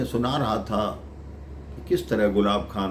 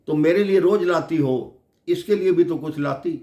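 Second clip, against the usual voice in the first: put-on higher, louder childlike complaint; child's mild demand; 6 semitones higher, 5 dB louder than usual. Little girl asks devotee for her friend's gift, demanding. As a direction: press the demand, pitched up and louder.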